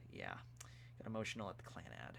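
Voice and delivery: whispered confession, barely voiced. Real, not acted. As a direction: whisper it like a guilty secret. Confessing he cried at Clannad.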